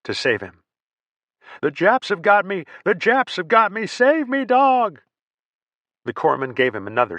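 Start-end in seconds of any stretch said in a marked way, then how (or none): 1.6-5.0 very high, loud panicked howl; howling in terror. Acted. Crazed soldier howling that the enemy has him, shrill and loud. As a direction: howl like a man being killed. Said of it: "He howled louder".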